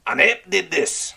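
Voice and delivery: can't believe what he hears; incredulous pirate question. Acted. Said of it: "Red Ram asked incredulously".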